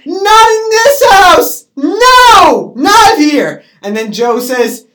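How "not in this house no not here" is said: high, loud wail of refusal, acted; shouted protest. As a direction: play a mother screaming to stop a fight.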